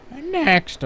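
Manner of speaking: drawn-out word cracking up high. Shown as a demonstration. Streamer showing a trick he finds hard, deliberate voice crack. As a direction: force the voice to crack into a squeak. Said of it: "purposefully get my voice to crack".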